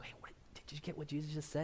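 hushed whisper among friends; huddled whisper. Acted. Disciples huddling, whispering about the parable. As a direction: whisper it so Jesus can't hear.

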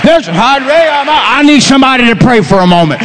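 full-force Pentecostal sermon delivery; fervent preaching. Real, not acted. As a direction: shout the promise out to the whole congregation.

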